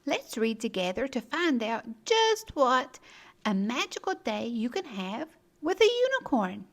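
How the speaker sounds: ordinary delivery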